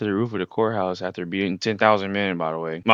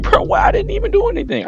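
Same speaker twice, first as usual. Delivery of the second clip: whiny protest; 10 dB louder than usual. Retold or imitated. Tom protesting the arrest.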